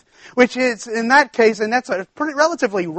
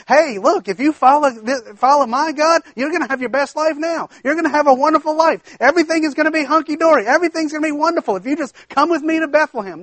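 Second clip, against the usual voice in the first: higher, fast, gushing promise of the good life; mock rosy sales pitch; 8 semitones higher, faster than usual. Preacher mocking a sugar-coated invitation Naomi never gave, gushing. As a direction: ham up a too-good-to-be-true prosperity pitch. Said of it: "no place in Naomi's speech that says".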